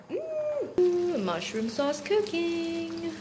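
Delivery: high, slow savoring tone; delighted. Acted. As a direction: sing it out happily. Showing the cooking pot.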